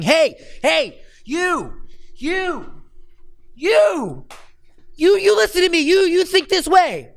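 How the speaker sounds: high, loud, pushy finger-wagging yell; barking lecture voice. Shown as a demonstration. Copywriting coach mocks pushy bark copy, high loud yell. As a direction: bark it at them like a scold. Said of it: "instead of saying".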